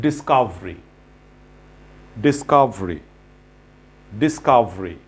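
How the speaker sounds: Discovery said as dis-COV-ri; discovery with no er before the ri; model pronunciation. Shown as a demonstration. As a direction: clip the end of Discovery to -ri. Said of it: "the pronunciation of these words".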